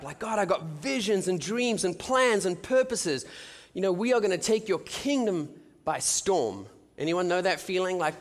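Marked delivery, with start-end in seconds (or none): 0.2-6.7 higher, drawn-out rallying declaration; pumped-up zeal, punching each item; fired up. Retold or imitated. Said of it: "I'm all fired up".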